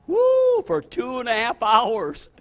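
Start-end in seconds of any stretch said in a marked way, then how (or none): 0.1-0.6 very high loud whoop; joyful woo. Real, not acted. Preacher exulting to the congregation, very high loud woo. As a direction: let out an ecstatic cry.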